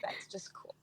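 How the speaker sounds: breathy whisper, barely voiced; whispered aside. Telling friends about her grandmother's sayings, whispered aside. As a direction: whisper it like a secret marvel. Quoting herself, hushed aside.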